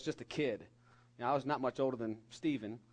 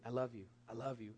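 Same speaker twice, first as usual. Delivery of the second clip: very soft, hushed repetition; tender near-whisper; 3 semitones lower, 9 dB quieter than usual. Retold or imitated. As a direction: murmur it as if to a sleeping baby. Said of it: "And I whispered it".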